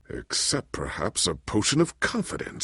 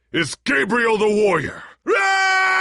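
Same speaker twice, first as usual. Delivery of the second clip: high, loud heroic roar; war cry; 13 semitones higher, 6 dB louder, slower than usual. Acted. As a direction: roar out your battle name.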